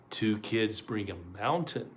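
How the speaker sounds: plain reading voice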